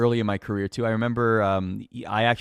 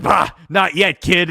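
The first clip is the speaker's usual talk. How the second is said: loud, higher scoffing brush-off; gruff dismissal; 7 semitones higher, 7 dB louder, slower than usual. Retold or imitated. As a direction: wave the kid away with a bark. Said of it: "he would be like".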